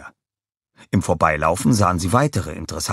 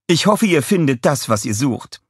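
friendly woman voice; 7 semitones higher, 4 dB louder than usual. A narrator's reading. Madge speaks to Minions.

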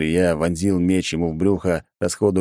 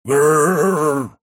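loud distorted horde growl; a rasping pack roar; zombie growl; 8 semitones higher, 4 dB louder than usual. A narrator's reading. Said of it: "взревели зомби". (рёв зомби)

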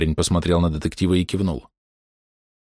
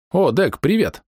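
much higher, bright with joy; glad greeting; 11 semitones higher, slower than usual. A narrator's reading. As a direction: greet a friend with delight. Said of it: "обрадовался Штольц".

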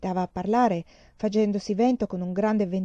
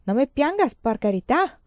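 higher, louder pleading cry; shrill cry; 6 semitones higher, 5 dB louder than usual. A narrator's reading. Donna Inés crying out to her weeping daughter, higher and louder. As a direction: cry out to stop her tears. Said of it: "Donna Inés strillò".

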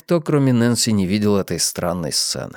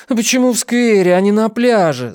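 high, loud whiny complaint; whining; 11 semitones higher, 4 dB louder than usual. Acted. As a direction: whine like a bored teen girl. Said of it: "заныла Нэнси". (капризно ноет)

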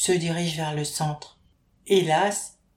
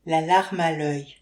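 usual speaking voice

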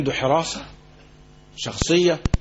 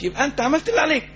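fervent reassurance; 9 semitones higher, faster than usual. Acted. Preacher reassures with rising fervor.

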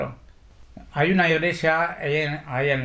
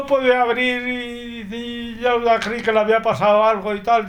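higher voice imitating a worried woman; 9 semitones higher, 3 dB louder than usual. Josefina telling how frightened she was, imitated in a higher voice. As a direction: retell her panic in her own high voice. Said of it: "casi llorando".

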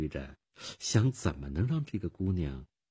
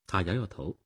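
ordinary delivery

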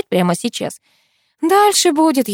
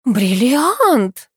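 higher, breathy echo of an exclamation; breathy awe; 5 semitones higher, 3 dB louder than usual. A narrator's reading. Katya echoing her friend about the ring, breathy. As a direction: repeat it with an awed breath. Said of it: "с придыханием повторила Катя".

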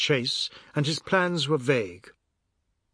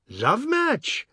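much higher, incredulous whoop; gleeful cry; 9 semitones higher than usual. A narrator's reading. Ajoda jeering at Biswas's marriage, high gleeful cry. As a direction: shriek it up in gleeful disbelief. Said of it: "Ajoda cried".

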